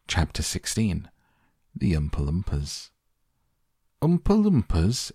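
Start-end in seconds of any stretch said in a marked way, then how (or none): 4.0-5.1 high, loud incredulous rise; astonished question. A narrator's reading. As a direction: echo it in disbelief. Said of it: "everyone said at once".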